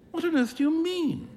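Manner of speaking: startled, high-pitched outburst; exclaiming in surprise. A narrator's reading. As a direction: blurt it out in disbelief. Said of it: "exclaimed Huey".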